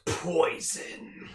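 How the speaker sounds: a hint of an evil whisper. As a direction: whisper it like a villain. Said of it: "it has such an evil voice to it".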